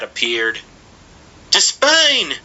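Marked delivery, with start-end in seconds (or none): 1.5-2.4 put-on high breathless boy's cry. A narrator's reading. Boy bursting in on the servant, high panting cry. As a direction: burst in gasping the name. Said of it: "he cried, panted".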